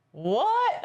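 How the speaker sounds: a confused guard who can't follow; puzzled guard. Retold or imitated. The Italian guard baffled by a Southern accent, sounding puzzled. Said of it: "and he's like".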